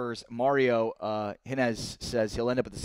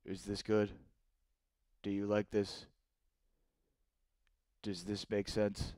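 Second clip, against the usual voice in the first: low, soft monotone impersonation; Kawhi Leonard; 5 semitones lower, 9 dB quieter than usual. Acted. Host doing an impression on a viewer's request, low monotone. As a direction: drop down into a quiet, dead-level deadpan impression. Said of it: "can you do an impersonation".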